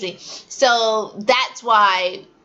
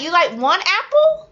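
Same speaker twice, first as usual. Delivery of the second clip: teacher question; 7 semitones higher than usual. Retold or imitated. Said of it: "I'd be like".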